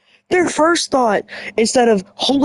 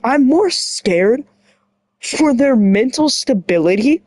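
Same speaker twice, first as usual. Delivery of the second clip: usual speaking voice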